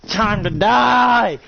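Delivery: high, loud, very slow menacing line; villain's declaration. Pretend. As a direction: drag out the doom line from the sky. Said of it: "And he's just like".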